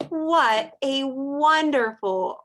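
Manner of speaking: ordinary talk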